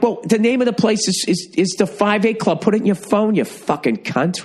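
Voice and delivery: very high-pitched, exasperated yell; mock rage, slowing onto the final insult; retold angry outburst. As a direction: yell back at his energy, pitch pinned high. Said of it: "I went to his energy".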